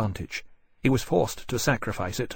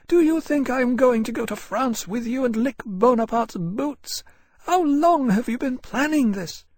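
higher, contemptuous scorn; angry young woman; 13 semitones higher than usual. Acted. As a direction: spit the insult at a traitor. Harcourt defiant at traitor.

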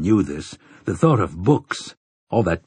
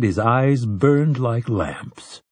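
plain reading voice

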